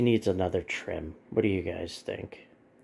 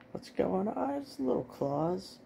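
high cooing; 11 semitones higher, 3 dB quieter than usual. Real, not acted. Cooing at the dog.

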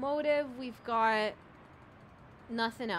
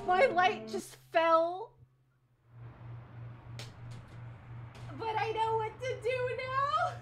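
sing-song; 12 semitones higher than usual. Real, not acted. Playful sing-song game comments.

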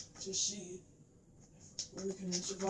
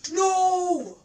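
sudden loud high cry of dismay; shout; 9 semitones higher, 15 dB louder than usual. Real, not acted. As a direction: yell it out in real shock. Friend loses his items.

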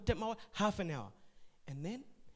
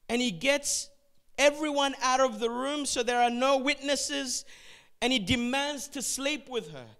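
louder, intense narration building tension; dramatic sermon storytelling; 5 semitones higher, 7 dB louder than usual. Acted. Preacher retelling Tamar's story, loud and intense. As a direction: tell it like a gripping scene.

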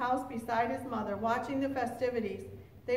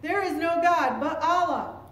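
loud, raised-pitch shout; crying out; 5 semitones higher, 7 dB louder than usual. A narrator's reading. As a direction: cry it out forcefully. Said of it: "staggering around the room crying".

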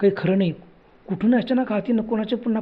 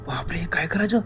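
hushed, much softer terrified question; frightened whisper; 7 dB quieter than usual. Acted. Friends see another hand, hushed fearful whisper. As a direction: breathe it out in dread.

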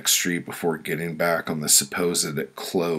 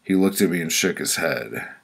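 usual speaking voice

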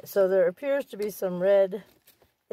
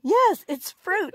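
insistent; 10 semitones higher, 6 dB louder, slower than usual. Real, not acted. Arguing cake is healthy.

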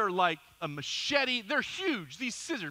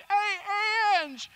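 retold yell; 8 semitones higher, 3 dB louder than usual. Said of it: "and I'm yelling".

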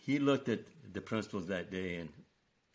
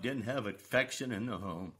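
usual speaking voice

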